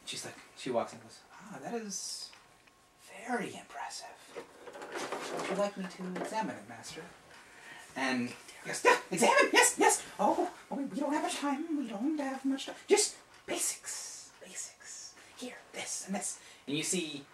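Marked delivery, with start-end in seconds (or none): 1.3-7.0 soft, higher, deferential; polite apprentice. Acted. GM voices Jolien, the apprentice. 8.8-12.7 very high, squeaky, rushed; hurried wizard. Acted. GM voices Saul again. 12.9-16.4 high breathy whispered instructions; urgent whisper. Acted.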